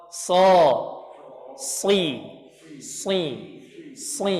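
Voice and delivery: si said slowly with the tongue pressed flat to the roof, a heavy emphatic s; shad demo. Shown as a demonstration. Teacher shows how shad sticks to the palate. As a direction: hold the tongue against the palate on si. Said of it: "Contohnya huruf shad".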